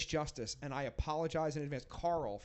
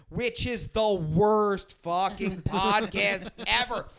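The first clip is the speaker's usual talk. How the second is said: slow, much louder, stretching every word; emphatic rant; 4 semitones higher, 11 dB louder, much slower than usual. Real, not acted. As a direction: drag it out in disgust. Ranting about a bad podcast.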